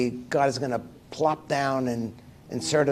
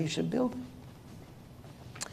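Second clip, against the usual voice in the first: put-on whispered child; 4 semitones higher, 14 dB quieter, much slower than usual. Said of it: "Ask the Rebbe".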